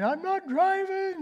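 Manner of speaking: high, slow, tearful protest; a scared boy refusing to drive again; retold crying. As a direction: plead through a mock sob. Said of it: "I start crying".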